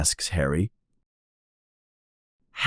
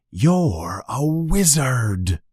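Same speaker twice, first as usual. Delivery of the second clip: slow, weighty revelation; a touch of awe, drawn out; solemn giant; 5 semitones higher, much slower than usual. A narrator's reading. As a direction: reveal it slowly, savouring each word.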